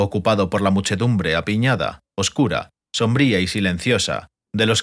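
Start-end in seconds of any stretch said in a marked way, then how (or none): none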